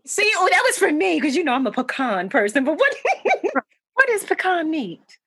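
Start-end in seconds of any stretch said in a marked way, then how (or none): 3.0-3.6 high burst of laughter. Real, not acted. Amused by pecan meat.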